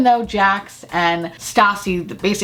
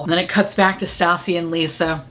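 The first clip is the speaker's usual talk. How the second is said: usual speaking voice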